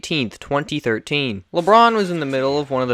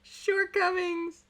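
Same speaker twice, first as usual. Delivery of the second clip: squeaky pun echo; 10 dB quieter, much slower than usual. Shown as a demonstration. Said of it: "I wrote that into the script".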